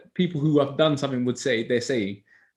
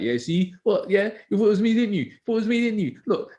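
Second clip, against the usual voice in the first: cheeky kids gloating, voiced much higher; taunting kids; 7 semitones higher than usual. Teacher mimics pupils taunting him, high and smug. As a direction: crow like smug pupils let off the hook.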